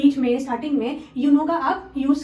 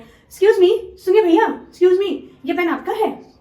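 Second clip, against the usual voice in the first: higher and louder, hailing a stranger; polite louder call to a passer-by; 5 semitones higher, 7 dB louder, slower than usual. Shown as a demonstration. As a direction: call across to get his attention. Calling a stranger for attention.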